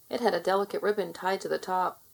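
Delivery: ordinary delivery, nothing marked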